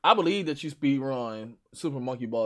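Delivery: normal talking voice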